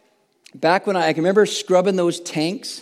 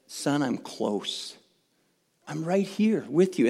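slow and much softer, lower, like a gentle father; tender father; 3 semitones lower, 7 dB quieter than usual. Retold or imitated. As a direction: reassure your son close by in the dark. Father's comforting voice recalled.

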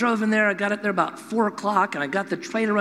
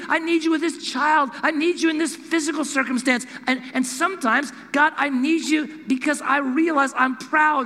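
rising, impassioned pulpit voice, lifting higher on the key lines; fervent preaching; 7 semitones higher than usual. Acted. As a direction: preach it with building conviction. Preaching to congregation.

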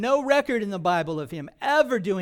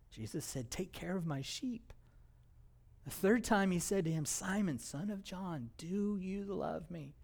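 tender, much softer reading; soft scripture reading; 9 dB quieter than usual. Acted. Preacher softly reading Jesus questioning Peter, tender and hushed. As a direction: read it gently, like a confession.

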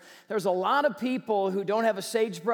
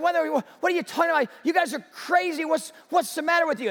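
higher, fast, exasperated disbelief; as if the crowd has lost its mind; incredulous firefighter; 5 semitones higher, faster than usual. Acted. Firefighter to townspeople, fast incredulous outburst.

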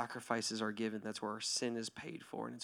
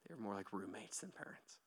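soft whisper; 8 dB quieter than usual. Real, not acted. Tender altar-call moment.

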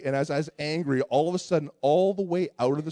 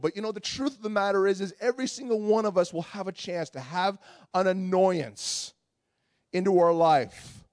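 usual speaking voice

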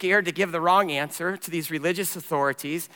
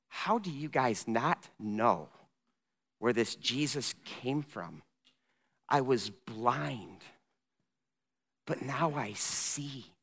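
slow, low, softened disbelief with long pauses; mild bewilderment; 4 semitones lower, 7 dB quieter, slower than usual. Acted. Young man pushes the Pharisees' buttons, quietly bewildered. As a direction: spell it out softly, as if baffled by them. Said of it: "in complete bewilderment, pushing their buttons again".

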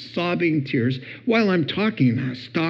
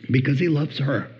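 normal talking voice

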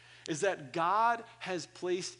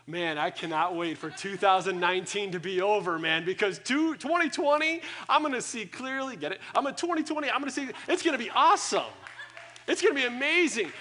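hyped up; 4 semitones higher, 6 dB louder than usual. Retold or imitated. Hyped for twenty twenty.

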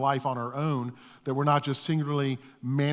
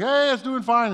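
cheery reply; 9 semitones higher, 6 dB louder than usual. Retold or imitated. Neighbor waves back.

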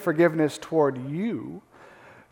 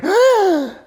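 high sharp gasp; shocked gasp; 11 semitones higher, 5 dB louder than usual. Retold or imitated. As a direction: gasp as if scandalized. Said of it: "And again".

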